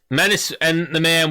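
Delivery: ordinary talk, nothing marked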